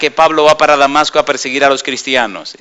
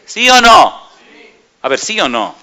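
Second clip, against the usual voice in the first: calling out; 3 semitones higher than usual. Real, not acted. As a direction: push the congregation to answer. Preacher demands answer from congregation. (el predicador exige respuesta a la congregación, en voz alta)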